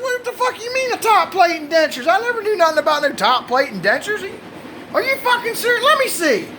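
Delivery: high-pitched mock disbelief; feigned shock. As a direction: act floored, voice shooting way up. Husband acts shocked at the confession.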